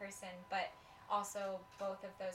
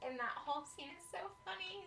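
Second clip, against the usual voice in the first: ordinary talk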